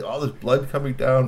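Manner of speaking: usual speaking voice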